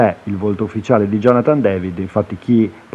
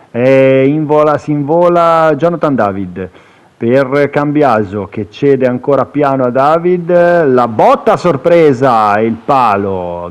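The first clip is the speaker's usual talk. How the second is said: rising excited play-by-play; breathless italian goal commentary; 3 semitones higher, 4 dB louder than usual. Acted. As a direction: build to the shot on the post. Live commentary on the shot.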